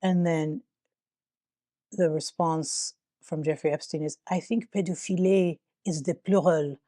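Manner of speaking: put-on deliberately awful French accent reading the emails; hammed-up French accent, lightly done; bad French accent. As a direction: mangle it in a cheesy French accent. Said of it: "I'm trying to do French accent horribly".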